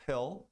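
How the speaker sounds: "Pill" with a clear final L; pronounced L. Shown as a demonstration. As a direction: finish "Pill" on a real L. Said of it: "Se você não pronunciar o L".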